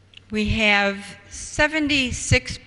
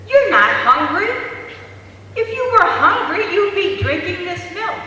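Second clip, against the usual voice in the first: much higher, louder, accusing mother's voice; scolding mother; 10 semitones higher, 4 dB louder than usual. Retold or imitated. Mother to baby refusing the bottle, higher and louder scolding. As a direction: snap at the baby like a frustrated mom.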